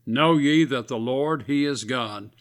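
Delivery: normal talking voice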